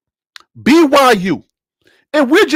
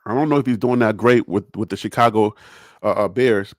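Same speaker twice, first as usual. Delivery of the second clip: much lower and softer muttered aside; 12 semitones lower, 6 dB quieter than usual. Real, not acted. Unsure aside about Caleb on the Bears, low and soft. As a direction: mumble it under your breath.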